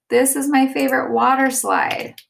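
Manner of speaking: high, loud excited exclamation; exclaimed. Shown as a demonstration. Teacher demonstrating an exclamation to the class, excited. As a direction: say it like you love the ride. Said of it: "we're exclaiming it".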